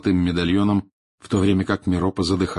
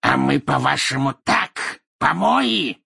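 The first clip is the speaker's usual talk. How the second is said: high-pitched, affronted cry; indignant, pitch soaring to the end; angry outcry; 7 semitones higher, 4 dB louder, slower than usual. A narrator's reading. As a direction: cry out at the insult. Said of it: "вскричал Мракс".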